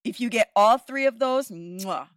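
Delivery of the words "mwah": smacked kiss sound, "mwah"; soft, appreciative lip-smack kiss; chef's kiss. Shown as a demonstration. Podcaster praising a show that gets all three, chef's kiss. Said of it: "chef's kiss".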